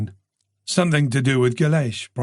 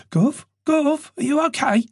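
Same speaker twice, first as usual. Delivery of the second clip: anxious, much higher voice; worried. A narrator's reading. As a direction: check on him anxiously. Charlie worried for Moran.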